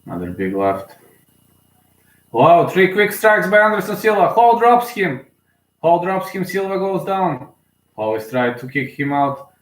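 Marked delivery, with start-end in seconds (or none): 2.3-5.2 high, loud shocked play-by-play; sudden thrilled outburst; excited shout. Real, not acted. Live reaction to knockdown. 5.8-7.5 high urgent play-by-play; stunned commentary; excited call. Real, not acted. Live reaction to knockdown.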